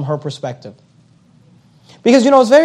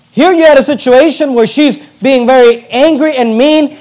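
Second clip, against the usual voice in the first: preaching swell; 6 semitones higher, 5 dB louder than usual. Acted.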